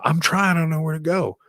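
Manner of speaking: half-hammed helpless protest; high flustered plea. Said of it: "And I go".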